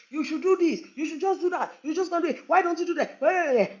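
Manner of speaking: high, rapid-fire bossy advice; imitating a friend who won't stop advising; pushy advice-giving friend. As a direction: pile on the advice without letting her speak. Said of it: "But come and see advice".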